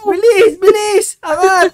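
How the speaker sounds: high, loud, drawn-out urging; frantic game-play shout; urgent shouting. Acted. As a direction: yell to hurry a teammate along. Urging player to jump from fire.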